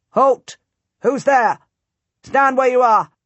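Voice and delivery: loud, high challenge from the wall; sentries shouting. A narrator's reading. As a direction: bark it down from the battlements. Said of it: "they shout down at me".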